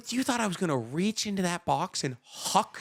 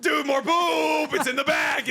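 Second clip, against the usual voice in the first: excited shout; 8 semitones higher, slower than usual. Real, not acted. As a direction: shout it out like a gleeful prank. Joking about a gift of poop.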